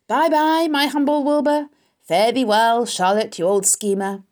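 loud, high, gloating goodbye; gleeful rat farewell. Acted. The rat taunts Wilbur and Charlotte, loud and gleeful. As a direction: crow it out like a rat off to feast.

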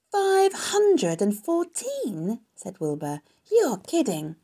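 high, loud, drawn-out disbelief; astonished. A narrator's reading. Wilbur hears how many eggs, slow high astonishment. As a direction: stretch the number out in amazement.

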